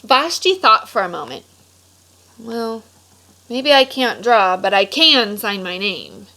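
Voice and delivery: lively storybook read-aloud voice; animated picture-book narration; reading to children. A narrator's reading. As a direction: read it like story time for a class.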